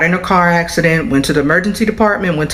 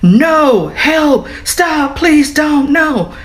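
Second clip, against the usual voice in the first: high, half-restrained mock screams for help; imitated terrified screaming; 10 semitones higher, much slower than usual. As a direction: act out her panicked pleas, pitched way up. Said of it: "she starts screaming to the top of her lungs".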